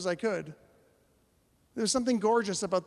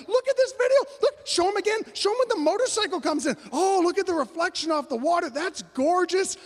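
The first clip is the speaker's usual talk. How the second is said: high, loud imitation of a thrilled woman; excited gushing; 11 semitones higher, 6 dB louder than usual. Woman showing friends the motorcycle video, excited. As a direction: gush and show it off to everyone.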